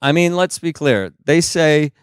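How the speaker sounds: normal talking voice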